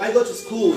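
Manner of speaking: "I go to school" with a drop on "school". Shown as a demonstration. Teacher asking everyone to repeat nicely, falling on "school". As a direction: let "school" slide downward. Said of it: "ভয়েসটা উপর থেকে নিচের দিকে যাবে".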